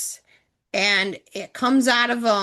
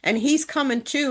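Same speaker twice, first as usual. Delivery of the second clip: normal talking voice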